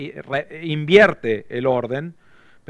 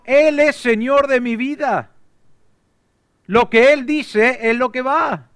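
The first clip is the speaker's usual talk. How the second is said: full-force preaching; 9 semitones higher, 5 dB louder than usual. Acted. Preacher voicing the believer's pledge to the Lord, full force. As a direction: cry it out as a pledge.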